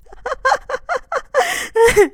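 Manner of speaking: high, loud hysterical laugh; shrieking laughter. Acted. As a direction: let the laugh burst out high. Laughter bursting out.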